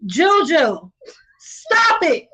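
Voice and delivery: playful loud outcry; shouting protest. Real, not acted. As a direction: yell at her to stop.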